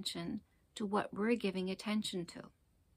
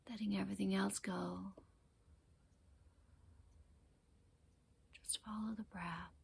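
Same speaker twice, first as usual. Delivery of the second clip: guided meditation; 8 dB quieter than usual. Acted. Teacher guiding listeners to focus on the breath, calm meditation voice. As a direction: ease them gently into their breath, unhurried.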